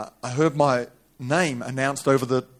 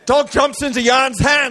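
high, raised preacher's cry; high-pitched dramatic sermon telling; impassioned preaching; 11 semitones higher, 8 dB louder, slower than usual. Acted. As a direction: preach the story at full pitch. Preaches at full force.

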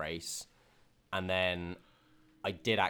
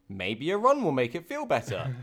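higher, louder mock optimism; mock hopeful; 6 semitones higher, 5 dB louder than usual. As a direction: retell a naively hopeful thought to yourself. Retells her naive hopeful thought.

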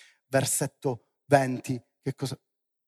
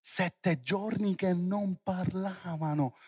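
drawn-out, softer repeat; slow emphasis; 4 semitones higher, 3 dB quieter, slower than usual. Real, not acted. Preacher lets seven days without speech sink in, slow and emphatic. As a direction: stretch "giorni" and "parlavano" slowly, letting it sink in. Repeats the phrase slowly for effect.

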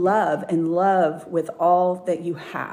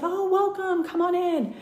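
much higher, sweet welcome; bright greeting voice; 12 semitones higher than usual. Retold or imitated. Bride welcoming the hairdresser at her door, high and sweet. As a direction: greet her like a cheerful hostess.

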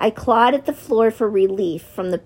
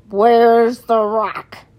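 slow, louder angry snarl; 5 dB louder, much slower than usual. A narrator's reading. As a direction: snarl the question, stretching it out. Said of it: "With a snarl, he asked".

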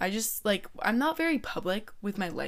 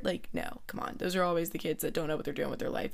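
ordinary talk, nothing marked

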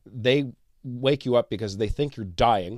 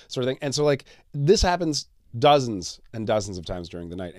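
ordinary talk

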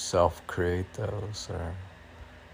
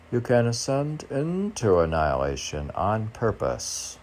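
louder, measured recital of a poem line; poem reading; 6 semitones higher, 5 dB louder than usual. Shown as a demonstration. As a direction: read it out like a verse, louder. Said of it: "I'm reading the advice from my own poetry".

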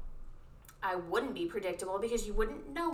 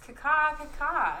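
bird caw; 6 semitones higher, slower than usual. Pretend. Bird noise imitation.

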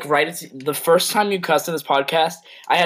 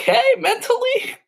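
very high squeaky concern; falsetto disbelief. Acted.

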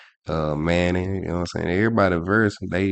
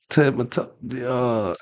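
ordinary talk

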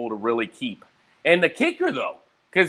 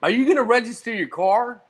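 nagging roommate; 8 semitones higher than usual. Retold or imitated. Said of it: "She was like".

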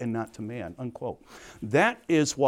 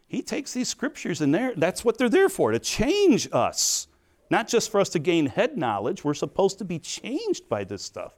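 higher, louder, pressing the point home; preaching with fervor; 6 semitones higher, 3 dB louder than usual. Acted. Preacher urging congregation to be changed, fervent.

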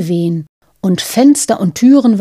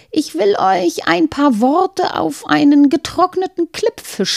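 put-on higher, pitying old woman; 7 semitones higher than usual. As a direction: sigh with pity as an old woman. Old Lapp woman speaks.

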